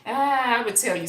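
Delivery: usual speaking voice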